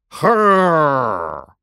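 loud growling roar; lion growl. Acted. Lion growls back at Little Bear, loud.

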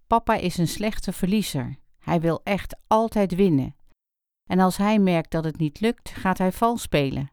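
usual speaking voice

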